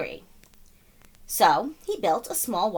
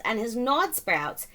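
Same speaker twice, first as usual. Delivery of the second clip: plain reading voice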